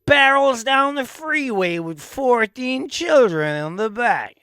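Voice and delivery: high, slow dramatic announcer voice; mock police-chase narrator, drawn out; impression of him. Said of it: "He'd be like".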